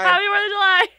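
high, loud holiday yell; mock festive shout. Podcaster capping a silly bit for Independence Day, high festive shout. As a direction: holler it like an over-the-top cheer.

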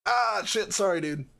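mildly acted alarm, high then dropping; high-pitched playful oops; startled apology. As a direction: blurt a mock-startled apology. Acting startled apology for cannon shot.

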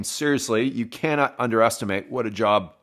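ordinary talk

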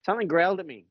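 high-pitched retold alarm; alarmed protest. Child tells the campers something growled, alarmed retelling. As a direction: imitate a spooked kid insisting.

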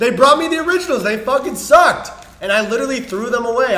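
loud, worked-up complaining; loud rant. Retold or imitated. Ranting about the cookies.

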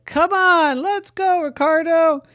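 squeaky high monkey voice; a giddy, high-pitched monkey; silly clown. Acted. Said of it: "and said".

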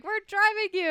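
high, bright, eager dad voice; excited dad. Retold or imitated. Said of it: "he's so excited".

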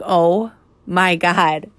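slow, drawn-out dismay; retold stunned realization; stunned disbelief. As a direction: stretch out each word as the sight sinks in. Teacher sees stuck head, retold.

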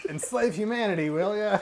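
a hint of comic alien; alien's line. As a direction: sneer it like a cartoon villain.

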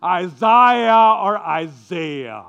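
Isaiah first as eye-ZYE-uh, then as eye-ZAY-uh; Isaiah drawn out, first with "eye", then with "ay" in the middle; two ways of saying Isaiah. Shown as a demonstration. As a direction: contrast the two Isaiah sounds slowly. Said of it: "I don't know which one is correct".

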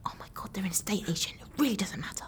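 hushed unvoiced whisper. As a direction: whisper so no one hears you. Hiding, whispering in van.

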